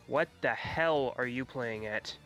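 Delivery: ordinary delivery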